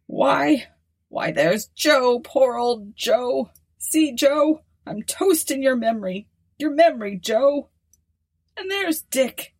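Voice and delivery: put-on high, quavering old man calling out to ghosts. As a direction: raise the voice high, tender and wistful. Old man toasting dead comrades.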